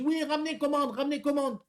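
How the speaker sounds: high, slowed broken French in a put-on foreign accent; mock foreign accent. Driver mimics the angry customer on the phone, mock foreign accent. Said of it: "je vous fais l'accent".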